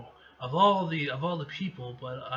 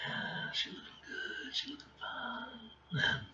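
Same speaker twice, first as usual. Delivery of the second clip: slow, soft whisper; whispered admiration; 9 dB quieter, slower than usual. Real, not acted. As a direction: breathe the compliment to yourself. Admiring girl Rogue in panel.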